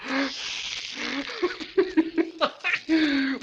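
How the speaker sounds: high mad laughter; maniacal cackle. Acted. Madman cackling at the silenced bells.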